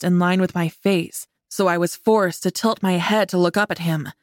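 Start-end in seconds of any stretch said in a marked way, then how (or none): none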